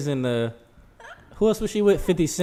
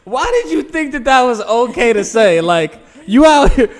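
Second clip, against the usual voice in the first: loud, high disbelieving yell; retold outraged friend, shouting; incredulous shout; 8 semitones higher, 9 dB louder than usual. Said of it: "And he was like".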